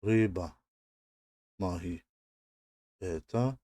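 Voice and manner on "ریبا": ریبا said as Russian "ryba", stress on the first syllable; ریبا as a teacher's careful example; Russian word. Shown as a demonstration. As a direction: model ریبا clearly with a falling end. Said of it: "مثل ریبا".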